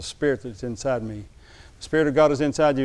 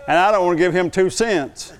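chuckling through the words; amused, half-laughing complaint; 4 semitones higher, 4 dB louder than usual. Real, not acted. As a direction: laugh at your own stinginess. Laughing at his own reluctance.

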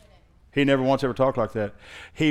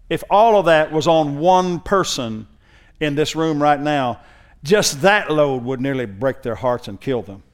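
full-force preaching; 2 semitones higher, 5 dB louder than usual. Acted. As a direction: preach it with rising intensity. Preaching builds again.

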